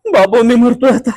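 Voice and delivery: high, loud cry of distress; hammed-up desperate lament; panicked wail. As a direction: wail for help as if dying. Panicked over his lost phone.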